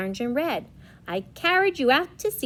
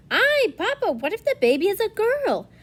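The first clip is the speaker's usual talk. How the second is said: high mother voice; 10 semitones higher, 5 dB louder than usual. Acted. Mother voiced in read story.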